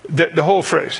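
ordinary talk, nothing marked

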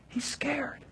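scared whisper, barely voiced; a frightened whisper; hushed fear. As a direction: breathe it out like a secret. Describing Pilate's fear, hushed.

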